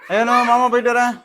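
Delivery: calling out goodbye. Real, not acted. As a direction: call out a goodbye so all can hear. (சத்தமா கூப்பிட்டு விடைபெறுதல்)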